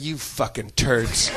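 low, slow, dry contempt; unimpressed barman, dragging each word; scornful bartender. Retold or imitated. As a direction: mutter it low and slow at two fools. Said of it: "the bartender's looking at us like".

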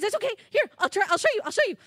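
a friend eager to show how it's done; Murray encouraging. Acted. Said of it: "And Murray says".

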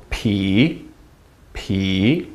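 "Pi" low, then rising; low fall-rise on "Pi"; dipping third tone. Shown as a demonstration. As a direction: sink on each "Pi" and swing back up. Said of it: "Third tone".

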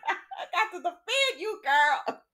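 half-laughing defense of her friend. Real, not acted. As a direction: laugh through it. Laughing while scolding.

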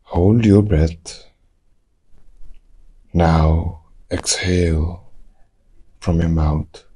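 usual speaking voice

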